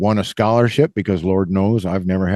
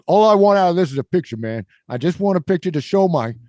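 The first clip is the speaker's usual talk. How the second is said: high, quick imitation of a pleading New Yorker; mock New York accent; 7 semitones higher than usual. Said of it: "New York guy said".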